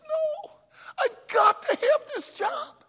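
very high, wailing clown plea; hammed-up desperate begging. Fired clown begs the manager for his job, wailing high. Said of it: "the clown just begged".

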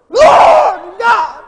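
very high, very loud shriek; screamed like a girl. Retold or imitated. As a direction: shriek it in sudden terror. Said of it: "I screamed like a girl".

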